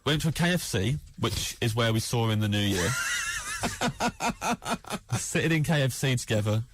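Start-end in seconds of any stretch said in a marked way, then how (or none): 2.9-5.1 a burst of high laughter breaking out; big high laugh. Real, not acted. Comedian cracking up at his own KFC story, high laughing. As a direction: laugh hard at the memory.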